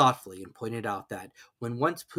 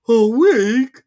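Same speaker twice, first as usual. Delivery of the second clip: glum Pooh; 11 semitones higher, 13 dB louder than usual. A narrator's reading. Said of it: "said Pooh glumly".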